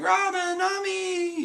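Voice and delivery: very high, drawn-out name calls; two friends calling each other's names joyfully; happy reunion calls. Retold or imitated. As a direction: greet an old friend with delight. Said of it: "they're like".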